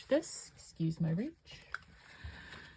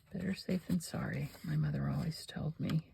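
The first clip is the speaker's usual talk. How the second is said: whispering; 9 dB quieter than usual. Real, not acted. Whispered aside.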